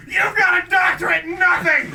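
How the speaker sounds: angry shout. Acted. Mother in grocery store scolding a stranger, furious shout. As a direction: yell it furious and high. Woman yelling at aisle stranger.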